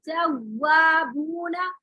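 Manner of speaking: तव्वाबूना read very slowly, lips rounded on the doubled व; correct tajweed reading. Shown as a demonstration. Teacher modelling the correct तव्वाबूना for students, slow and rounded. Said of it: "तव्वाबूना ऐसे पढ़ेंगे".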